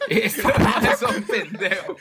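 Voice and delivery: words through laughter, slow; laughing while talking. Real, not acted. Cohost laughing while calling them idiots. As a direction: still cracking up. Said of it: "le da tanta risa".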